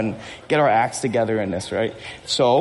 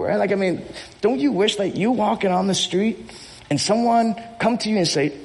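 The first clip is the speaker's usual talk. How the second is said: usual speaking voice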